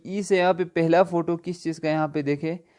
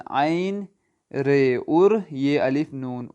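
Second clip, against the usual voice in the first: normal talking voice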